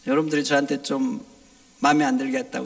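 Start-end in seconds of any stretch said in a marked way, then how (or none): none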